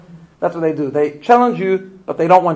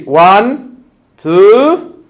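louder and higher on each number; counting out loud; 4 semitones higher, 10 dB louder than usual. Pretend. Parent counting down at a child who won't leave the park, rising loud. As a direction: call out the count like a warning to the kid.

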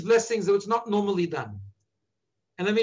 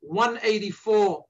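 slowly; slower than usual. Shown as a demonstration. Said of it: "I'm gonna say this slowly".

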